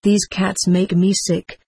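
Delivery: plain reading voice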